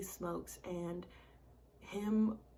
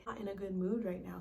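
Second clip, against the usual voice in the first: usual speaking voice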